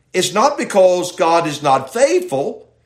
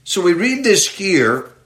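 ordinary delivery

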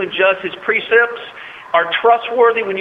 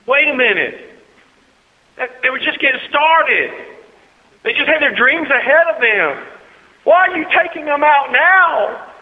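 higher, questioning plea to God; pleading protest; 11 semitones higher, 4 dB louder than usual. Acted. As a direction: object in a raised pitch, stressing each point. Acted protest to God over deaths.

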